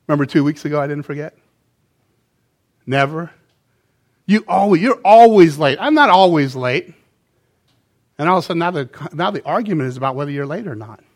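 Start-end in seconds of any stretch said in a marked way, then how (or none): 4.3-5.8 loud, higher accusing jab; mock spouse accusing, much louder; acted accusation. Acted couple argument. 5.8-6.9 loud, higher indignant denial; mock spouse snapping back defensively; acted defensive retort.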